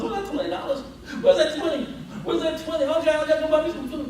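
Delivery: high, laughing voice hunting for the twenty; hammed-up searcher calling through the house; mock frantic searcher. Preacher mocks someone hunting for money.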